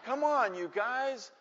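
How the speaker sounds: put-on high boyish plea for help; scared pleading. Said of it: "I got scared".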